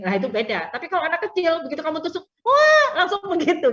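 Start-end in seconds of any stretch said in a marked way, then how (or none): 2.4-3.7 much higher and louder, a hammed-up child wail; child's loud outburst. Teacher imitating a small child jabbed by a needle, loud mock wail. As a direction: play a kid yelping at the jab.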